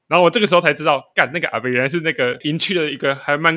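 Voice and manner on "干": ordinary delivery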